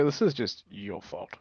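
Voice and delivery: usual speaking voice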